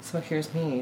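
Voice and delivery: ordinary delivery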